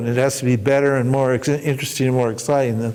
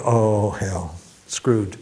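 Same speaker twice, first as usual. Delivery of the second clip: defeated genie; 5 semitones lower, much slower than usual. Acted. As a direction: mutter the defeat. Genie realises he is trapped.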